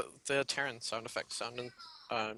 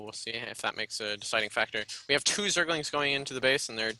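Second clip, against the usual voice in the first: normal talking voice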